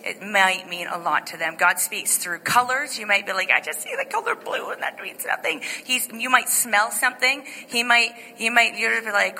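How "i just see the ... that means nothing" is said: much higher put-on voice, dismissive; mock doubter. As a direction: play the skeptic brushing it off. Mocks a doubting listener.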